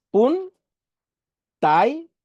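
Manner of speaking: "pun, tai" as two isolated beats; syllable by syllable. Shown as a demonstration. Teacher counts syllables for the class, "pun, tai" slowly separated. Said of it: "esas son dos".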